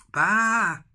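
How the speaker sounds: high, loud bleating baa; sheep baa. A narrator's reading. Grandad reading to the children, a high loud sheep's baa. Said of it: "said the sheep".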